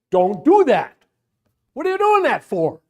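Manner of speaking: loud, high, forceful scolding; emphatic rebuke. Acted. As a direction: snap it at the weeping mother, hard. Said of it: "The Greek is rather emphatic".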